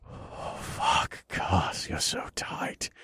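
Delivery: soft unvoiced breathy whisper. A narrator's reading. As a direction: breathe it out, barely voiced. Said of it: "I breathed".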